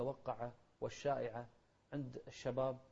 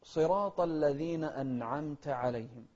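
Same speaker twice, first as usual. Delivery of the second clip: slow, louder measured verse; Quran recitation; 2 semitones higher, 6 dB louder, slower than usual. Shown as a demonstration. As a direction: recite firmly and slowly. (ترتيل بطيء بصوت أعلى)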